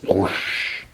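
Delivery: breathy unvoiced whoosh of a blast wave; whoosh sound. Narrator imitating the shockwave spreading, a soft breathy whoosh. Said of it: "imitating shockwave".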